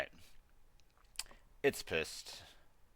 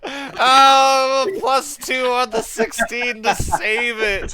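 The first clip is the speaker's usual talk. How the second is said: laughing, half sung, slow; 16 dB louder, much slower than usual. Real, not acted. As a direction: laugh while singing the numbers. Player laughs after saving.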